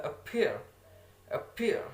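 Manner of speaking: "appear" with the stress on PEAR; teacher's model of "appear", leaning on the end; stress on second syllable. Shown as a demonstration. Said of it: "The stress that's in the second".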